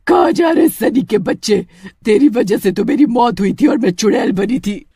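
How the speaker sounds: loud furious witch cry; angry witch shout. Acted. Witch catches Sunny in anger, loud shout. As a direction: roar at the boy who killed you.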